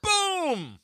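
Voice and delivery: high, loud triumphant "Boom"; game-show cheer; excited boom. Real, not acted. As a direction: celebrate the right answer. Quiz answer right, cheering.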